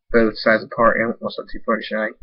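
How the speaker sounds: plain reading voice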